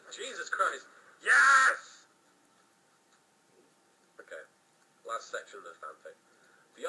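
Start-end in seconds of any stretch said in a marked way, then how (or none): 1.2-2.1 loud, high yell; loud boastful yes; cocky shout. Acted. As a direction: belt it out proudly. Jack boasting loudly.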